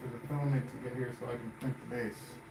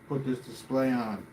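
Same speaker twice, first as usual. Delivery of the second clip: ordinary delivery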